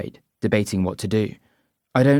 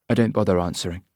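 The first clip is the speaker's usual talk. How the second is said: ordinary delivery, nothing marked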